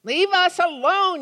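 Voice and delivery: loud, high-pitched driver's yell. Acted. Driver speeding past two clergymen, yelled. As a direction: yell it out at the roadside preachers. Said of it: "yelled the first driver".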